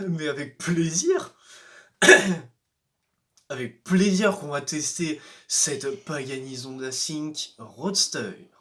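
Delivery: ordinary talk, nothing marked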